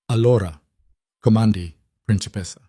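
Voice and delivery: put-on spoken in the old Italian way, like a father. A narrator's reading. Sal to Elena, old-fashioned Italian. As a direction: lilt the Italian gently, old-world style. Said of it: "in Italian, the old way".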